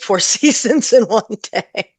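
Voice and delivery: amused laugh mid-phrase. Real, not acted. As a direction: let a real laugh break into the line. Amused at Melbourne weather irony.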